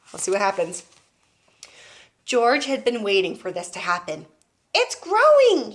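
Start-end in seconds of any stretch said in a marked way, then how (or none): 4.7-5.7 high, loud thrilled cry; excited yell. Acted. George yells to Grandma as the hen grows. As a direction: shout the news. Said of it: "he yelled".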